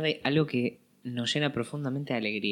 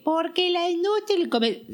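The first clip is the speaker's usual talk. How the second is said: much higher, mocking snob; mocking pop critic. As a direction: parody a snobbish critic.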